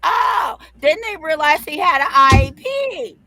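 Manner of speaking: loud, high gleeful reveal; triumphant shout. Real, not acted. Mom shouts the punchline to her friends in triumph. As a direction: shout it out as the big payoff.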